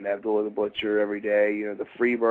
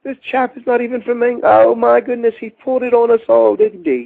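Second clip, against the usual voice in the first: very high, loud mock British voice calling across the room; calling out to Linda; 14 semitones higher, 10 dB louder than usual. Adrian Street calling Miss Linda over, high loud British mock. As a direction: camp Englishman summoning his wife in disbelief. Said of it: "and he goes".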